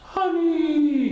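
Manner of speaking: loud drawn high honey; loud announcement. Acted. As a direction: savour the word loudly. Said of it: "he announced again".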